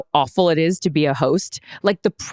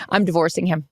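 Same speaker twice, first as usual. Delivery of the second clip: usual speaking voice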